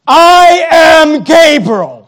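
slow, grand angelic declaration; booming angel. Shown as a demonstration. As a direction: announce yourself like a mighty angel. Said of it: "I think that's how he said it".

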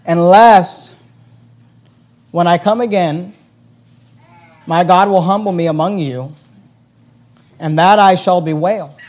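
loud scripture reading; preached scripture. Acted. Pastor reading Paul's words to his church, loud and preached. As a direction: proclaim the verse to the church.

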